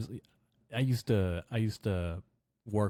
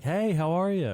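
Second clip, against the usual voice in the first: high, louder imitated cheery hello; smiling greeting; 9 semitones higher, 5 dB louder than usual. Imitating the teacher greeting a forgotten pupil, smiling. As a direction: mimic a smiley passing pleasantry. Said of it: "she smiled and she was like".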